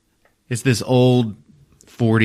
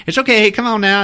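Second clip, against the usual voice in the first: much higher, coaxing voice; soothing coworker voice; 12 semitones higher, 4 dB louder than usual. Retold or imitated. Octavia coaxes Amelie out of the room, high soothing voice. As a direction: coax her friend out of the room gently. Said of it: "She's like".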